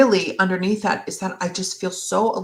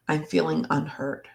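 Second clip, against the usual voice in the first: ordinary talk, nothing marked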